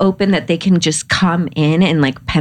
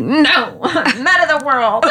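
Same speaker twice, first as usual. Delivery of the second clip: laughing; 8 semitones higher, slower than usual. Retold or imitated. She answers in play.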